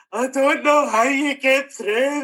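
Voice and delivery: high, weepy mock-sobbing voice; imitating tearful brother. Older brother crying after the eulogy, high mock sobbing. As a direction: ham up a brother choked with tears. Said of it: "tears and snotters".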